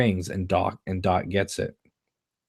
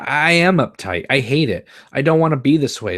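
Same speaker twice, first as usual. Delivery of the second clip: higher, louder and fast; upset confession; 6 semitones higher, 6 dB louder than usual. Acted. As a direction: blurt out what you hate about yourself. Donna confessing her frustration.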